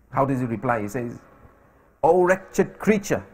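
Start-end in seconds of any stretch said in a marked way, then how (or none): 2.0-3.2 slow, raised-pitch proclamation; defiant retort. Retold or imitated. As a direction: address the king with slow, solemn scorn. Captive defies the threatening king.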